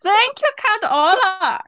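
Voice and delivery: slow, loud, theatrical thanks; mock grand thanks. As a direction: ham it up like a solemn toast. Mock thanking vampire for sacrifice.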